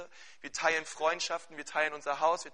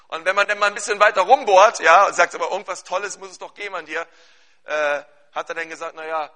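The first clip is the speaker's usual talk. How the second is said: loud, high, driving sermon voice; fervent preaching; 4 semitones higher, 12 dB louder than usual. Acted. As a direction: preach it out with full force to the congregation. Preaching at full force.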